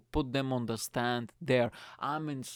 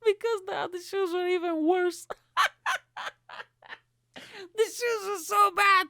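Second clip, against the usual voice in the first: high, loud words squeezed out through laughter; can barely speak for laughing; laughing hard; 4 dB louder than usual. Real, not acted. Telling the audience about the shoes, cracking up.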